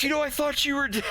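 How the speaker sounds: quick squeaky retort; Farrah voiced. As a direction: rattle it off high as Farrah. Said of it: "And she's like".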